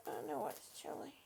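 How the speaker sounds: soft whispered comfort. Real, not acted. Owner soothing her cold dog, whispering. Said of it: "third repeat of the line".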